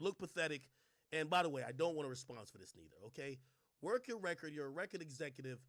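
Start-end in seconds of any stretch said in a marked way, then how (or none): none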